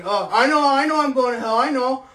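loud, high mock-cheerful admission; drunk shouting. Drunk neighbor shouting that he knows he's going to hell. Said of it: "and he".

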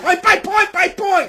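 "point by point by point" slow, high and loud; stress on point. Acted. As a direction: punch every "point" in "Point by point by point". Hammering the demand home.

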